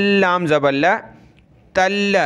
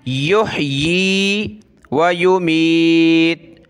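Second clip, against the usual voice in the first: Quran recitation; much slower than usual. Shown as a demonstration. Teacher recites the verse slowly for pupils. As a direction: intone it slowly like tilawat.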